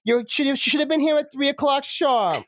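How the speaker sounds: high-pitched snooty scolding; put-on prissy reprimand; stuck-up pageant lady. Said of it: "stuck-up, uh, s-, pageant lady".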